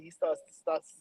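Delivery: ordinary talk, nothing marked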